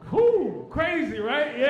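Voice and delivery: high, loud whoop of disbelief; amazed whoop. Real, not acted. Comic marvels at his friend's words, loud and high. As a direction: blow out a stunned "whew". Reacting to friend's poetic line.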